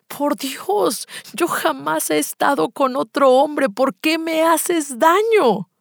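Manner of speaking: high-pitched, heartbroken protest; wounded, pleading lament; anguished crying plea. Acted. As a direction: act it falling apart, slowing on the final question. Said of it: "yo lloraba, Gaby. Me desbarataba porque decía".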